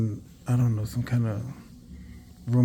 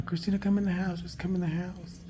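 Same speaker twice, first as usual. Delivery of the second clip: higher, imitating a mother calling her daughter in; 8 semitones higher than usual. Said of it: "She just kept telling her".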